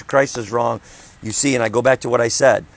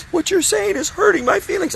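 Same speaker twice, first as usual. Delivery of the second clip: high, whiny imitation of a hurt young man; mock the hurt kid in a squeaky, much higher voice; whiny young elder. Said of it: "this young elder's going".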